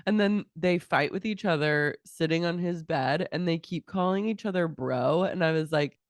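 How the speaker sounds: usual speaking voice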